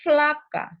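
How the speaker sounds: "flaca" high and loud; sonido cambiado. Shown as a demonstration. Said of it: "mira, el sonido ha cambiado". («flaca» en voz alta y aguda)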